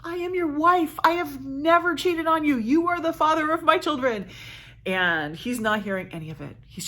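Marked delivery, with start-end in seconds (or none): 0.0-4.3 high, pleading wife's voice. Retold or imitated. Hermione to her accusing husband, high and pleading. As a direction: beg him as his wronged wife. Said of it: "And Hermione's like".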